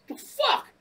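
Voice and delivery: high-pitched indignant disbelief; squawked disbelief at a driver; incredulous outburst. Retold or imitated. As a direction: blurt it high, re-enacting your shock. Retold reaction to the driver.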